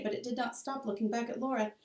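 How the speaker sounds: ordinary delivery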